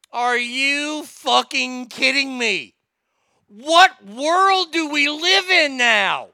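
slow, drawn-out, incredulous outburst; outraged. Real, not acted. Host reacting to the news story, outraged.

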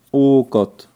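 flehend und laut gen Himmel gerufen; lauter Hilferuf zu Gott. Acted. Prinz fleht zum Himmel. (der Prinz ruft Gott laut um Hilfe an)

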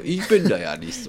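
playful mock. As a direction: say it back as a tease. Repeats own phrase playfully.

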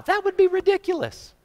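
very high, squeaky protest; incredulous squeak. Real, not acted. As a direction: squeak it out at the absurdity. Scoffing at one-style preaching rule.

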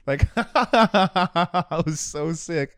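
laughing, high and slow. Real, not acted. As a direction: gush through laughter. Laughing while raving about fight.

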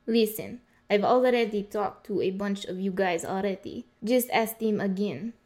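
waitress with a put-on Russian accent; Russian-accented English. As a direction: fake a Russian accent for tips. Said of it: "what happened to your accent?".

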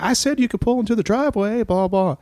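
high falsetto repeating herself; insistent woman. Retold or imitated. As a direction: push him again in a high voice. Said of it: "She's like".

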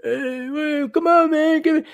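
high-pitched mock moocher voice; begging relative. Host mimicking cousins wanting the player's money, begging relative. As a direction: wheedle in a silly, very high voice.

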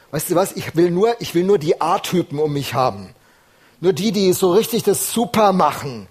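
preacher voicing an imagined snobbish God rejecting a doubter, loud scorn; mock dismissive God. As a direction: sneer at the weak believer. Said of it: "Gott wird nicht sagen so".

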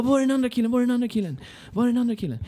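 high-pitched mimicry of urgent locals; frantic onlookers. Locals asking the seated rider about another victim, high frantic imitation.

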